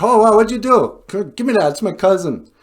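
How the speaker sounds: high, loud burst of alarm; acted-out excited outcry; startled exclaiming. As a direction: exclaim it loudly, demanding an answer. Acted Indians startled by arrival.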